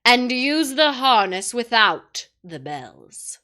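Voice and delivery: slow, loud commanding witch; the witch commands the dwarf; ordering. A narrator's reading. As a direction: give a cold royal order. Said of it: "ordered the witch".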